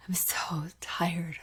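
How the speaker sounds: slow, breathy-free whispered sigh of exhaustion; tired whisper. Retold or imitated. Mom coming up from the office, exhausted whisper. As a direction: whisper it like you can barely stand.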